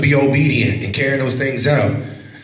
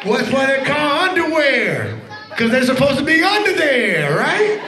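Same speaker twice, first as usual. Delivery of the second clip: higher, laughing joke delivery; laughing punchline; 8 semitones higher than usual. Real, not acted. Preacher joking to kids about underwear, laughing. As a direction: crack the joke through laughter.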